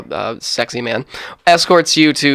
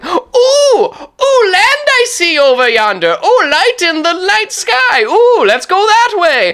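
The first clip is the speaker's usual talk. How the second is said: very high, loud, drawn-out whooping; Christopher Columbus; 5 dB louder, slower than usual. Acted. Captain in the crow's nest spots land, hammed-up high hooting cries. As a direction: crow from the masthead in mock excitement. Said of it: "Christopher Columbus up in the crow's nest going".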